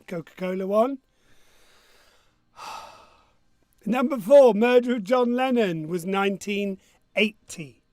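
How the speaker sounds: ordinary delivery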